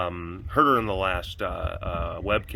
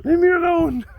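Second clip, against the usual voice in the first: whiny. Retold or imitated. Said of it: "I'm like".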